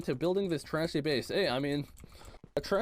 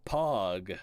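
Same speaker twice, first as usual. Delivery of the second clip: laughing pog; 8 semitones lower than usual. Real, not acted. After accidentally killing his sister.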